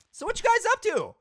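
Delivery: squeaky, loud playful chatter; silly high voice. Pretend. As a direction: chirp it in a put-on falsetto.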